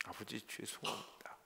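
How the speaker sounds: soft unvoiced whisper to the Father; hushed repentant whisper; whispered apology. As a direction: confess it under the breath. Retold repentant prayer, whispered.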